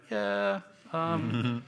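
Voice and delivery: high-pitched, stalling mock agreement; hesitant agreement. Studio people answering a casting idea, hesitant agreement. They react to French actresses idea.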